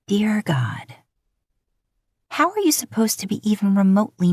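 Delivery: breathless whispered aside. Acted. Woman flustered by his voice, whispering to herself.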